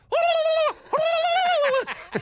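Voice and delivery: high, loud shrieking cackle aimed at the bull; imitated bull-scaring shriek. Farmer showing how to scare off a charging bull, high loud shriek. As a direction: let out a sudden piercing high cackle. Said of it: "and you go".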